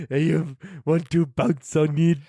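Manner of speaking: slow, botched vampire impression; High Horse. Co-host's failed attempt at the vampire, slow silly voice. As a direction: drag it out in a badly faked vampire voice. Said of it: "That didn't work".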